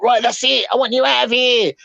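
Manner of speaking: a touch of her ordering him out; mock girlfriend. Retelling girlfriend ordering him out, lightly mocking.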